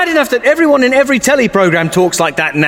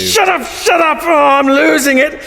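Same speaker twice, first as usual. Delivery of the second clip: higher, shouted shut up; frantic shout; 7 semitones higher than usual. Acted. Begging the sassy man to stop, frantic shout. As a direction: yell to drown him out. Driven mad by sass talk.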